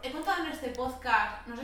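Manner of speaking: distant, off-mic voice; far from mic. Real, not acted. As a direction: speak from across the room. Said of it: "acércate al micro".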